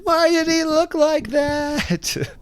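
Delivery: very high, slow, stretched wife impression; mock wife voice. Mimicking wife baffled by the filthy driver, very high and slow. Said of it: "My wife said".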